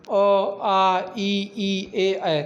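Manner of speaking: अ, आ, ई, ए, ऐ said slowly with lips spread; unrounded vowel demo. Shown as a demonstration. Teacher modelling unrounded vowels for the class, slow. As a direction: sound each vowel deliberately with unrounded lips. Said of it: "ओठ गोलाकार नहीं होते हैं".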